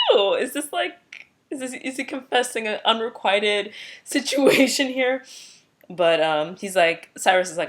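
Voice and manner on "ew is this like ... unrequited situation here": teasing. Retold or imitated. Teasing reaction to Cyrus.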